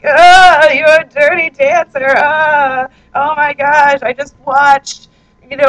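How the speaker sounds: mock weeping singer. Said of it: "why does he always sound like he's crying".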